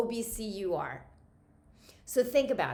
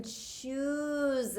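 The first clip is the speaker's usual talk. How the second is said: "choose" drawn out long and slow; stress on choose; 4 semitones higher than usual. Shown as a demonstration. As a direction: stretch out the word "choose" for emphasis. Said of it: "Notice how I'm emphasizing the word choose".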